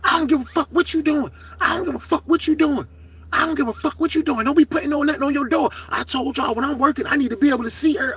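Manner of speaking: high, repeated defiant comeback; mock the officer brushing him off; imitating the officer's retort. Officer yelling back at the inmate.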